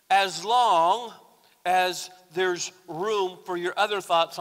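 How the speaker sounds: rising pulpit intensity; full-force preaching. Acted. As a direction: drive it home to the congregation.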